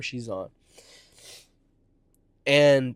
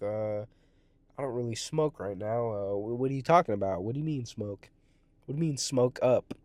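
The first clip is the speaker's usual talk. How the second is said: lower, softer retold reply; hesitant reply; 4 semitones lower, 5 dB quieter than usual. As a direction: back off quietly, unsure what is meant. Retold reluctant reply to smoking offer.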